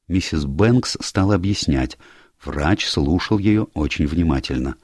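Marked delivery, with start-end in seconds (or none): none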